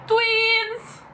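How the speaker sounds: high excited squeal. Real, not acted. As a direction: squeal it with delight. Excited cry over matching lipstick.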